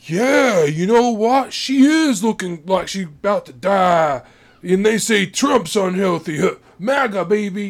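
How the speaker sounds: loud Trump supporter. Retold or imitated. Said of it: "go like".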